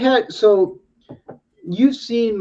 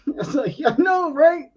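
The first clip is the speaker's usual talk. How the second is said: laughing; 12 semitones higher than usual. Real, not acted. Laughing while retelling.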